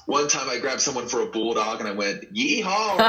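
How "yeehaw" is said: high, loud hammed-up Texan yeehaw; horrible cowboy yeehaw. Retelling his rookie days, hammed-up Texas yeehaw. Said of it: "I had a horrible accent".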